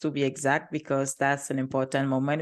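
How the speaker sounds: normal talking voice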